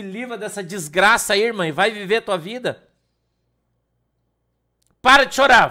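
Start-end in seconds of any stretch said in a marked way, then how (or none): 5.0-5.7 loud, high command to stop crying; stern shout. Retold or imitated. Pastor ordering a crying woman to stop, loud stern shout. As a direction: bark it at her to snap her out of tears. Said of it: "falei pra ela".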